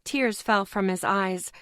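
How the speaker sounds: ordinary delivery, nothing marked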